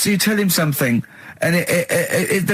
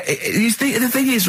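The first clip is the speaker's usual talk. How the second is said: ordinary delivery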